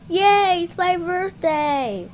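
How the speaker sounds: usual speaking voice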